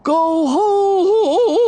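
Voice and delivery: high, loud warbling wind cry; yodeling wind. A narrator's reading. The wind warning Irene, high loud yodel. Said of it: "the wind yodeled".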